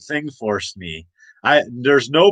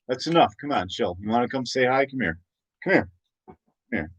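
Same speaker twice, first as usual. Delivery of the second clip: coaxing the dog; 2 semitones lower, 7 dB quieter, faster than usual. Real, not acted. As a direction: gently call the dog over.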